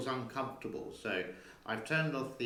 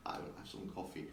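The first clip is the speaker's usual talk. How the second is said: soft, fast unvoiced whisper; whispered aside; 4 semitones lower, 10 dB quieter, faster than usual. Real, not acted. Owner quietly decides to drink her coffee, fast whisper. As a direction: murmur it just under your breath.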